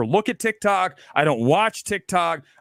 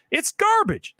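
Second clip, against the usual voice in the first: It's garbage pitched way up; much higher repeat; 13 semitones higher, slower than usual. Real, not acted.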